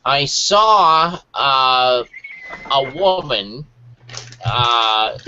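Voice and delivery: deliberately bad impression, drawn out with long pauses; David Lynch. Reading a quote for friends on request, hammed-up Lynch impression. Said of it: "Read it in your best David Lynch impression".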